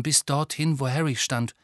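ordinary delivery

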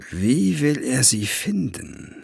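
slow, drawn-out character voice; menacing interrogator. A narrator's reading. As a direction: question the captive with slow, deliberate weight. Villain questioning a captive.

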